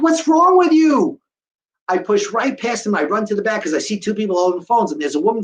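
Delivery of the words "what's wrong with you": loud, high, slow indignant demand; exasperated outburst. Retold or imitated. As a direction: let it burst out, stretched and pitched up. Outraged thought at unhelpful waiter.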